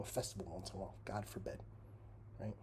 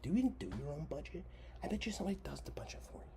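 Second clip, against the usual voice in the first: higher, hushed mocking jab; sneering whisper; 6 semitones higher than usual. Real, not acted. Mocking Holly.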